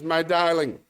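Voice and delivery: drawn-out, over-sympathetic Englishman's question; old Englishman. Acted. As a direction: play a theatrical old Englishman. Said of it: "An old Englishman's walking down the beach".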